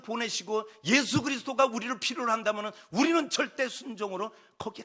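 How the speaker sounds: high, loud, driving sermon voice; full-force preaching. Acted. Preacher calling the congregation to absolute obedience, impassioned. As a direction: drive the call home with mounting force.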